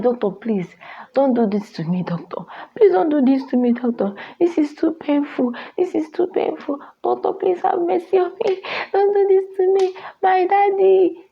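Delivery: high, pleading cry to the doctor; crying plea. Retold or imitated. Woman in pain pleading with her doctor, crying high. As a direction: beg the doctor through tears, rising. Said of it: "I was crying. I was pleading".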